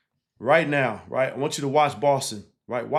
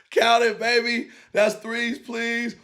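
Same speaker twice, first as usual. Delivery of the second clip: higher-pitched cheer as the lone Knicks fan; mock fan cheer; 8 semitones higher, slower than usual. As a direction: play the lone New York fan celebrating.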